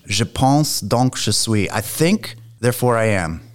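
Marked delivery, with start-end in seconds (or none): 0.1-1.7 slow, careful French pronunciation; French accent. Real, not acted. Philosophy student quoting Descartes to the host, French accent. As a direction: quote it slowly in good French. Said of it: "with a very good French accent".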